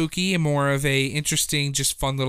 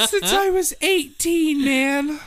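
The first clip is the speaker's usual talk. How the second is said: much higher, laughing pride; giddy. Real, not acted. Teller proud of his joke, high and giddy.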